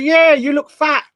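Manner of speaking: mock-blunt friend; 1 quoting friend. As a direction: blurt it out bluntly. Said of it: "And he said".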